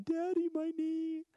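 put-on high, drawn-out little-kid wail; crying child. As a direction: imitate a hurt little boy calling for daddy. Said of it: "he's crying".

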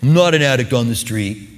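mock protest. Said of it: "a little stinging to us".

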